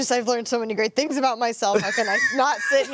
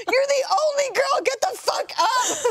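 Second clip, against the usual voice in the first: much higher, mock-shouted urging; urging coworker; 14 semitones higher than usual. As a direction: push her up in a high, laughing yell. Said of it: "And she was like".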